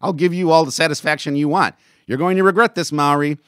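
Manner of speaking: loud, high-pitched angry tirade; heated insisting. Acted. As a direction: lash out at a rival, loud and high. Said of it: "Maori insisted".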